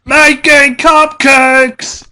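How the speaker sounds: high, loud, slow squeal of joy; happy announcement. Acted. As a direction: belt it out like a thrilled pony. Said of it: "Pinky announced happily".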